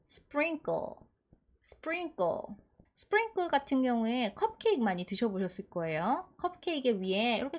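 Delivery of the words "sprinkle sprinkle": sprinkle as "spring-kkl", sounds joined up; model pronunciation of sprinkle. Shown as a demonstration. Teacher blending the sounds for learners, sprinkle as spring-kkl. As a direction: say sprinkle carefully, ending on a tense kkl. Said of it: "이렇게 발음하셔서".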